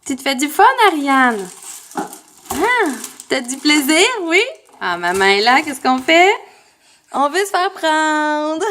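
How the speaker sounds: loud, teasing sing-song to a little one. Real, not acted. Maman catches bébé in les armoires, playful baby talk. Parle au bébé pris à fouiller.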